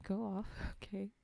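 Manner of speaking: ordinary delivery, nothing marked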